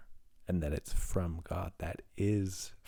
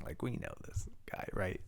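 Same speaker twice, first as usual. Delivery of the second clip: whispering; 8 dB quieter than usual. Real, not acted. Whispered aside to audience.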